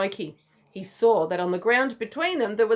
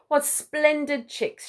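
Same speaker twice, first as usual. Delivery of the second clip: slow, higher proud mother emu; 7 semitones higher, slower than usual. Acted. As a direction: savour each word with pride. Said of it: "she said proudly".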